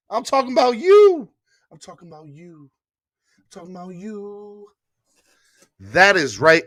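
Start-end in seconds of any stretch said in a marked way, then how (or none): 0.1-1.3 louder, higher punch on you; emphatic call-out. Real, not acted. Host repeats line, then drops away. 1.7-2.7 much softer, almost under the breath; hushed echo. Real, not acted. Host repeats line, then drops away. 3.4-4.7 distant echo. Real, not acted. Third take fading further.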